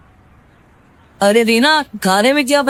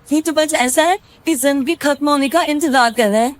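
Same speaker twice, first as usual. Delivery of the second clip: higher, lively grumbling wife; complaining wife; 6 semitones higher than usual. Acted. Reena complains to her friend about her husband, higher and lively. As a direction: vent to a friend.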